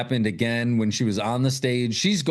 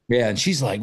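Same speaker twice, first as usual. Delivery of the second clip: ordinary talk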